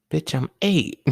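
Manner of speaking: put-on squeaky high-pitched little boy; mock kid protesting in a high voice. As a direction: pitch way up like an eight-year-old. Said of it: "This example comes from the Boondocks".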